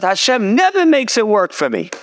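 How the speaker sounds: high, mildly exasperated complaint; acted-out throw-up-the-hands lament. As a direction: imitate a daughter giving up in frustration. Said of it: "she throws up her hands".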